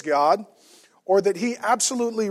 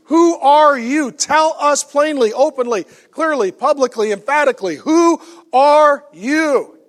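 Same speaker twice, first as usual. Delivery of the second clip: forceful demand; 7 semitones higher, 7 dB louder, slower than usual. Acted. Preacher voices the burning question.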